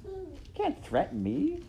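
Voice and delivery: very high-pitched royal protest; indignant princess. Acted. Princess refusing to be threatened, high and indignant. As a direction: object in a high, affronted voice.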